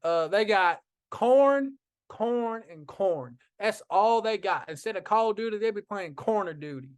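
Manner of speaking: mock Southern voice teasing a friend about corn; country accent. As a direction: play a folksy Southern uncle. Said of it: "my southern brother".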